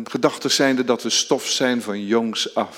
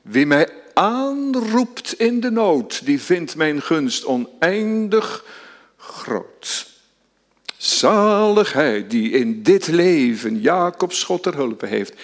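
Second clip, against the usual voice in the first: slow, higher psalm recital; psalm verse read with reverence; solemn recitation; 5 semitones higher, slower than usual. Acted.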